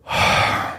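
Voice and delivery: heavy drawn-out exhale; long sigh. Retold or imitated. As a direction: let out a long, weary agent's sigh. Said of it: "long sigh, he goes".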